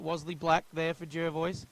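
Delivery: usual speaking voice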